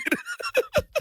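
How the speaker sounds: very high, wheezy giggle; laughing. Real, not acted. Comic admitting he watched, high squealing laugh. Laughing at own breastfeeding admission.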